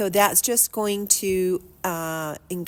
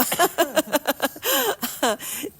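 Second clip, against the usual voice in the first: high falling laugh; 9 semitones higher, 4 dB louder than usual. Real, not acted. Laughing at team joke.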